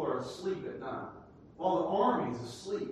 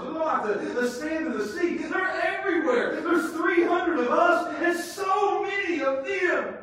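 high, loud laughing build; laughing excitement rising to a shout; excited; 8 semitones higher, 5 dB louder than usual. Real, not acted. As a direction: marvel at the odds, laughing. Excited preacher laughing at odds.

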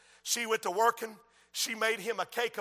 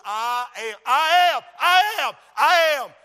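from plain to loud, higher shout; building declaration; 4 semitones higher, 7 dB louder than usual. Real, not acted. Preacher proclaiming Jesus' words, building to a shout. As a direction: build each I am bigger.

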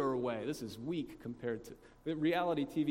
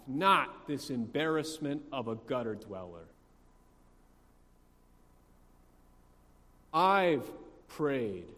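slow, loud, hammered list of merits; hammed-up aggrieved boasting, drawn out; indignant self-righteousness; 8 dB louder, slower than usual. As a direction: build to a shout on each "I". Elder son lists his earned merits.

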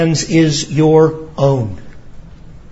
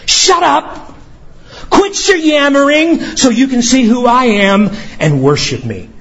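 sharp divine command; 6 semitones higher than usual. Acted. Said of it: "That's what verse ten is saying".

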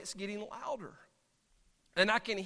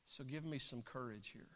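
frightened whispered prayer; 7 semitones lower, 14 dB quieter than usual. Retold or imitated. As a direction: pray quietly to God, afraid of the bear. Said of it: "And this is what I prayed".

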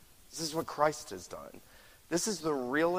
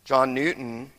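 ordinary talk

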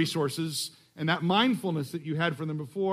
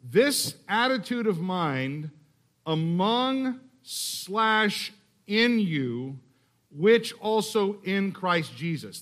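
slow literal reading; 2 semitones higher, much slower than usual. Shown as a demonstration. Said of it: "this is what I would offer you".

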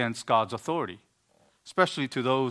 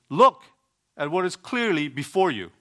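imperative; 3 semitones higher, 3 dB louder than usual. Retold or imitated. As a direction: say it as a sudden firm order. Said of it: "consider this as an imperative".

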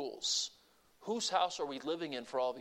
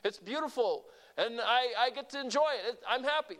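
higher-pitched, cheerful put-on delight; mock happy; 8 semitones higher than usual. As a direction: act out happiness over nice weather. Acting out circumstantial happiness.

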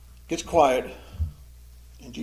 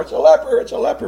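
higher and louder, alarmed crowd cry; horrified cry; 9 semitones higher, 8 dB louder, faster than usual. Acted. Crowd backing away from the leper, horrified cry. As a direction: recoil and warn everyone. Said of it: "all the crowds back away in horror".

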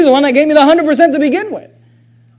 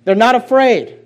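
usual speaking voice

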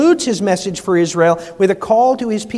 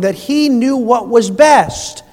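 usual speaking voice

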